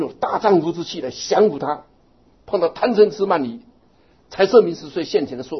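high, loud declaration of resolve; resolute vow. Acted. Monk rousing listeners to vow with full force. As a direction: proclaim your vow with a brave man's spirit. Preacher voicing determined practitioner.

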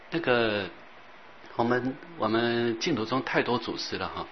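ordinary talk, nothing marked

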